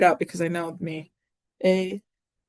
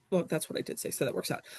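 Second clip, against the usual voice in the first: quiet aside; 3 semitones lower, 10 dB quieter, faster than usual. Real, not acted. Muttered aside.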